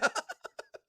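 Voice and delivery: short breathy burst of laughter; quick unvoiced cackle. Real, not acted. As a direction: laugh at your own joke. Laughs after joking about viewer.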